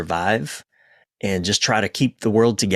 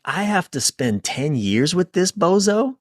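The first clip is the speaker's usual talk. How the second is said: higher pitched, dismayed voice; lightly voiced grumbling partner who wants out; a partner balking at ten years, mildly dismayed; reluctant partner; 7 semitones higher than usual. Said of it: "they're like".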